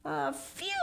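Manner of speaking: high, indignant "a few?"; boy outraged by the request; incredulous boy. Acted. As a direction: echo it in disbelief.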